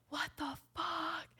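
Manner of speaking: high, hushed mock yell; whisper-shout it slowly, drawing out the outrage; mock furious. Boyfriend seeing the jar, imitated furious whisper-shout. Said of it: "He's so mad".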